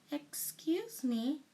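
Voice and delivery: put-on slow, high sweet old lady voice; a sweet grandmotherly greeting; bright old woman. A narrator's reading. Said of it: "she said brightly".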